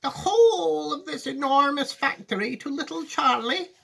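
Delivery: put-on high, near-crying old man; overwhelmed with emotion; tearful Grandpa Joe. Grandpa Joe asking Wonka, high and near tears.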